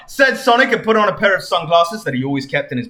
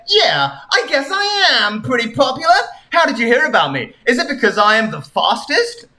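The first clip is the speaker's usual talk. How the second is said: cocky Sonic; 7 semitones higher than usual. Acted. Sonic boasting.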